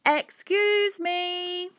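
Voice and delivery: high, drawn-out call; long, high-pitched "excuse me" call. A narrator's reading. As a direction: call out for someone's attention over noise.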